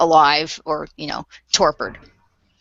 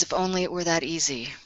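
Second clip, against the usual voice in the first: weary sigh; 9 dB quieter, faster than usual. Acted. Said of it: "she sighs again and she says".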